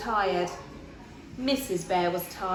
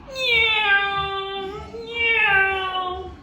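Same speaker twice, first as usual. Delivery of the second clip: high, loud drawn-out meow; cat meow; 10 semitones higher, 7 dB louder than usual. Acted. Baby Bear in bed playing, long loud meow. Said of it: "went Baby Bear".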